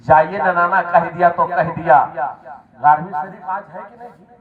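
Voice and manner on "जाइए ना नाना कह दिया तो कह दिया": loud, emphatic repeat; firm assurance. Acted. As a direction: declare it firmly and forcefully. Boy's reply repeated forcefully.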